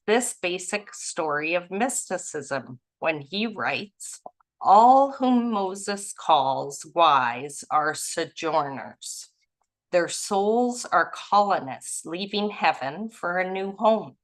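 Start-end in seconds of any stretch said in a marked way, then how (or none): none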